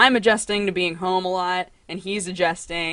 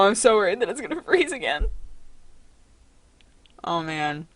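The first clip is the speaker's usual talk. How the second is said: normal talking voice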